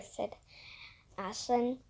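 ordinary delivery

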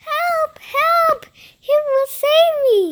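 very high, loud cries for help; high-pitched theatrical distress call; princess crying out. A narrator's reading. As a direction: cry out as a princess in danger. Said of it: "cried the pretty princess".

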